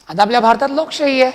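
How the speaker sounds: ordinary talk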